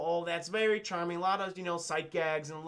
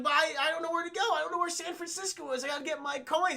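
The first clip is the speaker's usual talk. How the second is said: much higher, worried cartoon hedgehog voice; lost Sonic; 10 semitones higher than usual. Sonic pleading with Marsden, high and worried. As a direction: sound like a lost, high-voiced little hero. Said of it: "And Sonic's like".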